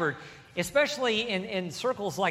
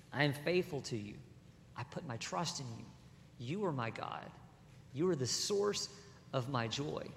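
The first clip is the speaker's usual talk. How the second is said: quiet, trusting, softer; humble voice; 4 semitones lower, 9 dB quieter than usual. Acted. Preacher voices David's humble trust, quietly and softly. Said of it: "we also hear another voice".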